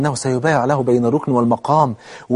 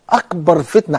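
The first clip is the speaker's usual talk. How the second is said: normal talking voice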